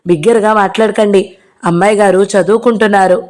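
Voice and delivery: usual speaking voice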